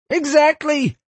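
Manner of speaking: very high, loud screamed outburst; piercingly high, exasperated yell; furious scream. A narrator's reading. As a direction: scream it like an enraged principal. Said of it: "the principal screamed".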